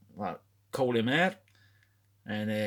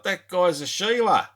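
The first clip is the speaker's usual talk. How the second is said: Mick Dundee quip; 8 semitones higher, 8 dB louder than usual. Retold or imitated. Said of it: "He's like".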